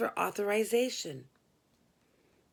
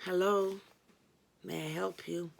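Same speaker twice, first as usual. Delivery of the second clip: somber; 2 semitones lower than usual. A narrator's reading. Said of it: "with a somber".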